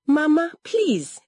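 high, loud appeal; pleading. A narrator's reading. Accused daughter begs her mother to listen, pleading.

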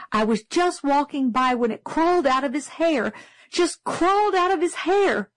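rising high into a frantic plea; pleading in shaken panic; desperate. A narrator's reading. Teacher telling the class about the cootie, desperate and rising high. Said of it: "Miss Caroline said desperately".

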